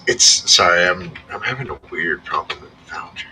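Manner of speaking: usual speaking voice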